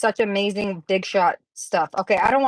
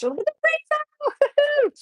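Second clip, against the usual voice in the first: high and slow, squealing with delight; thrilled, high and slow; slower than usual. Real, not acted. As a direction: gush over the rainbow. Thrilled by the craft pages.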